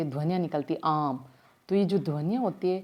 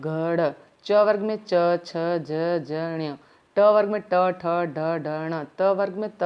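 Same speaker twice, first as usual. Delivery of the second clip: recitation; 4 dB louder, much slower than usual. Acted. Reciting consonant rows aloud.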